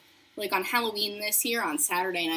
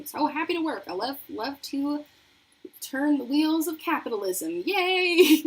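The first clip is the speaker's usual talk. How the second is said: higher-pitched fake cheer, building to a loud yay; mock enthusiasm; 6 semitones higher, 6 dB louder than usual. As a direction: overdo the happy employee act. Mock cheer for watching employers.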